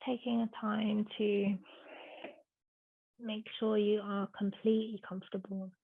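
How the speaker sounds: soft, hushed yoga instruction with long pauses; unhurried, softly spoken relaxation guidance; guided meditation. Real, not acted.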